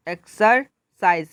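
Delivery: एक्सरसाइज slowly, broken after एक्सर; split into syllables. Shown as a demonstration. Teacher demonstrating a three-syllable word, एक्सरसाइज split up. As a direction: pause inside एक्सरसाइज before साइज. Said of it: "three syllables word है".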